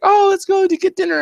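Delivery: put-on high, chirpy kid voice; cheery daughter. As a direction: sweetly suggest it like a kid. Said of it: "and she's like".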